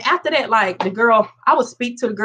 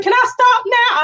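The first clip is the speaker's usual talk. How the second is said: mock crying plea; 13 semitones higher, 3 dB louder, slower than usual. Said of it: "I was crying".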